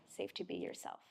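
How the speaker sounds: whispered, barely there; a soft unvoiced whisper to close the thought. Real, not acted. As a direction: let it fall to a whisper. Whispered close of her point.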